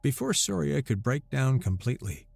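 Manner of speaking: ordinary delivery, nothing marked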